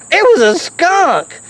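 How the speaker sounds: usual speaking voice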